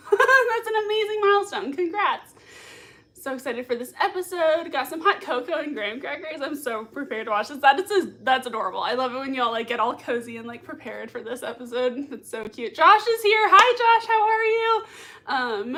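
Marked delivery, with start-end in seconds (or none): 0.1-2.3 high, loud, laughing praise; gushing and pitched up; excited congratulations. Real, not acted. Congratulates viewer on driving. 12.7-15.8 high, loud gleeful welcome; squealy delight, slowing on the last words; excited greeting. Real, not acted. Greets arriving viewer Josh.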